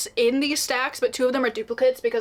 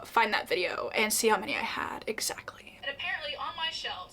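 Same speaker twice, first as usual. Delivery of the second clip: whispering; 8 dB quieter than usual. Real, not acted. Aside while looking something up.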